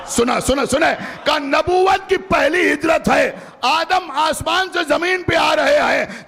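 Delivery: high, slow, drawn-out sermon voice; fervent preaching. Real, not acted. Preacher to the majlis gathering, fervent full-force preaching.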